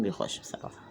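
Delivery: whispered aside. Real, not acted.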